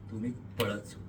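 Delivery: low, soft, held-back narration; hushed suspense. A narrator's reading. Narrator on what you do next, hushed. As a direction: let it drop softly before the burst.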